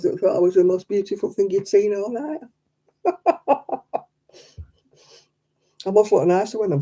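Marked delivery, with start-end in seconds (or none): 3.0-4.0 real laugh. Real, not acted. Laughing at own story.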